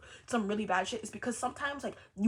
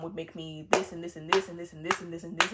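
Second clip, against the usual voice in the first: usual speaking voice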